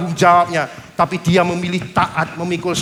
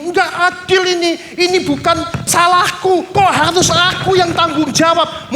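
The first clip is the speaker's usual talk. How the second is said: Simon crying out at the injustice, voice pitched up; indignant protest; 8 semitones higher than usual. Acted. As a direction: Simon complaining to the soldiers, higher indignant outcry. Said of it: "Dia ngomong gini".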